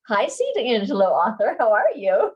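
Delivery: loud, laughing hello; laughing greeting. Real, not acted. Reader greeting an author who joined, loud and laughing. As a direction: call out a hello through real laughter.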